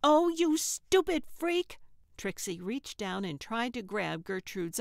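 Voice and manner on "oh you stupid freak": high, loud, exasperated shout; furious outburst. Acted. Trixie losing patience with Gertrude, furious shout. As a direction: explode in disgust.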